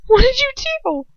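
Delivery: high, loud, panicked question; desperate cry. A narrator's reading. Captive girl asking her torturer, desperate and shrill. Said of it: "I ask desperately".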